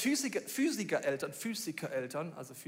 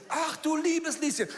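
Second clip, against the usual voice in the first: slow, higher, comic dismay; mock shocked oh dear; dismayed exclamation; 8 semitones higher, 4 dB louder, slower than usual. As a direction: say it like watching a crash happen. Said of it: "Und ich so".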